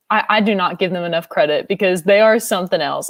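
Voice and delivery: ordinary delivery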